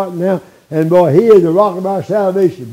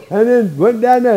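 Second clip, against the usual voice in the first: normal talking voice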